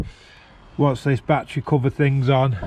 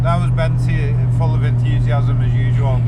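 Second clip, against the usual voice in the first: loud and higher, over engine noise; raised voice; 3 semitones higher, 7 dB louder than usual. Real, not acted. Driver to viewers on a road test, raised voice over the engine.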